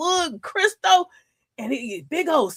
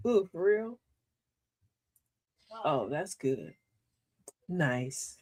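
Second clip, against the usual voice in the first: dry fake praise; 8 semitones lower, 10 dB quieter, slower than usual. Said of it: "They go".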